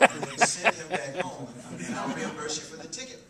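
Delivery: loud, high, laughing through the words. Real, not acted. Speaker laughing while reading a father's note. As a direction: laugh while you finish the joke.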